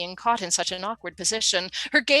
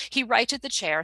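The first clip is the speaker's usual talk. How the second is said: ordinary delivery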